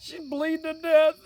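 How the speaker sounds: high-pitched hysterical dad parody; mock panic. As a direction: plead frantically with the doctor. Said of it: "we're over there in tears".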